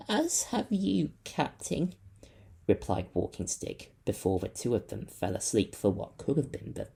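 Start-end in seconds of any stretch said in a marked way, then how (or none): 0.1-1.9 tender farewell. A narrator's reading. Walking Stick tender, high slow.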